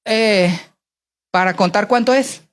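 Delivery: usual speaking voice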